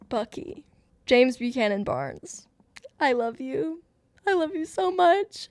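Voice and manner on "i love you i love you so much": high-pitched fangirl gush; adoring gush. Real, not acted. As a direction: coo it adoringly, pitch climbing on the repeat. Gushing to a favourite character.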